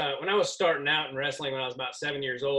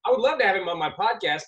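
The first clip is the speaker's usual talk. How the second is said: ordinary talk, nothing marked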